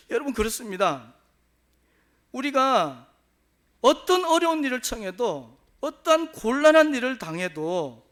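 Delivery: loud, fervent sermon exhortation; preaching. Acted. Pastor exhorting the congregation to their mission, fervently preaching. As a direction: urge the congregation with rising force.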